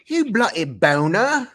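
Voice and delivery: slow, high hammed-up British insult; mock British accent. Cohost joining the British bit with a slow mock accent. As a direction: drawl it like a cartoon Brit. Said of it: "The accent".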